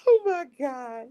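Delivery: high-pitched, helpless real laughter; laughing. Real, not acted. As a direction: try to explain while cracking up. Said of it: "I'm only laughing because".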